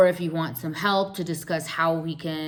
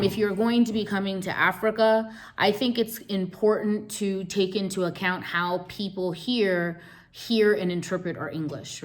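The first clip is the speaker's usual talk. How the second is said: ordinary talk, nothing marked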